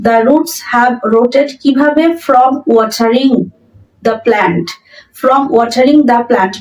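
very slow, word by word dictation; slow model sentence. Shown as a demonstration. Teacher reading the example sentence aloud to students, very slow and clear. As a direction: spell it out slowly for learners. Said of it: "আমাদের আগে লিখতে হবে".

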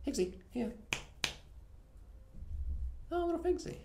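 high, soft coaxing call to the dog; sweet dog call. Real, not acted. Owner calls shy dog Figsy, high coaxing. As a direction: coo softly to little Figsy.

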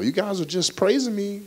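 high-pitched, laughing disbelief; incredulous protest. Retold or imitated. Player to fickle media critics, incredulous. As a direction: protest the turnaround with amused indignation.

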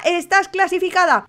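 normal talking voice

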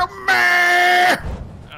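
shout. Real, not acted. Hype yell before match.

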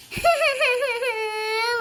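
hammed-up crying wail; a sobbing sung moan; mock sung sob. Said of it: "அழுதுட்டு ஆர்ப்பாட்டம்".

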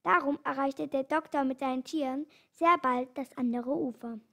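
ordinary delivery, nothing marked

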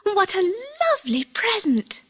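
high, airy excited gasp; out of breath. A narrator's reading. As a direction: say it breathlessly, delighted. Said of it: "She was rather out of breath".

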